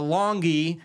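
longie stretched out slowly and playfully; drawn-out word. Pretend. Plugging their long video, a slow, playful longie. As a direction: savor the silly word longie.

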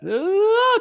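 long, very high, loud wail; imitated howl. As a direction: mimic a panicked student howling under canopy. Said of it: "And she is howling".

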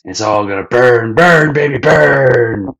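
loud, high, screeching glee; crazed Pearl. Retold or imitated. Pearl setting the restaurant on fire, screaming and high. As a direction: scream it like a maniac pyromaniac. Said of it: "Pearl's like".

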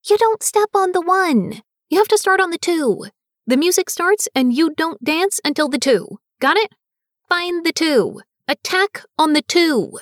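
high, loud frustrated teacher yell. Acted. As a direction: shout the count at him. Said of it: "I yelled out".

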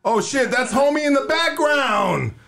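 high, drawn-out holler; excited outburst. Real, not acted. Host hollering about homie in the background, excited outburst. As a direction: call it out high and stretched. Reacts aloud to a caller.

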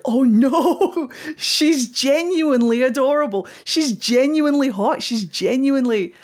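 smitten gushing. Retold or imitated. Gushing over a character's charm.

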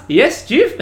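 mild mock recruit reply; innocent recruit. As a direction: answer brightly and sincerely. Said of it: "but I just love that".

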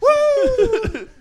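very high, loud laughing whoop; cheering whoop. Real, not acted. Friend cheering the fart, high loud laughing whoop. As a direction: cheer it like a victory.

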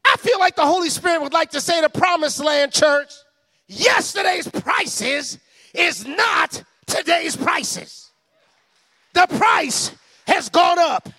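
loud, high preaching build. Acted. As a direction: preach it with full force. Preaching at full force.

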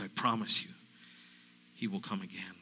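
normal talking voice

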